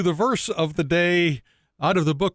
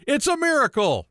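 high-pitched exclamation of awe; high, awestruck storytelling exclamation; exclaimed in wonder; 10 semitones higher than usual. A narrator's reading. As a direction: cry it out like a joke's amazed shepherd. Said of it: "and exclaimed".

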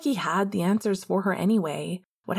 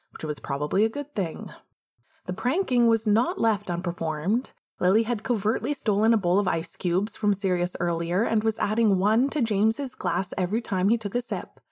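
ordinary delivery

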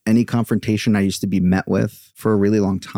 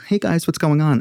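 put-on high, light boyish voice; mock high young greeting; imitating his young voice; 9 semitones higher than usual. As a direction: play your teen self sounding girlish. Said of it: "You sound like a girl".

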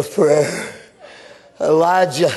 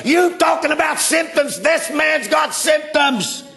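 preaching at full force; 8 semitones higher than usual. Acted. Preacher telling the church about Jonah, full-force preaching. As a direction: drive the story home like a revival preacher.

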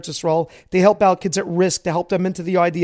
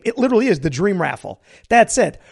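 ordinary talk, nothing marked

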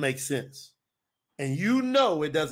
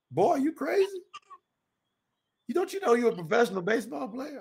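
high, incredulous scolding; mock-astonished country granddad; imitated Southern granddad; 7 semitones higher than usual. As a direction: quote granddad in disbelief, voice jumping up. Voices granddad scolding his decision.

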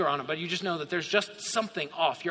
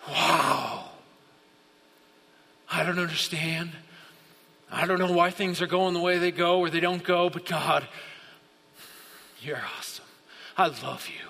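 tender awe. Acted. Preacher as worshipper telling God of love, hushed and tender.

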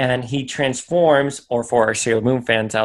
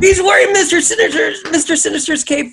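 dramatic high voice; 7 dB louder, faster than usual. Acted. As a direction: announce it like a thrilled superfan. Dramatic villain-cape reveal, repeated.

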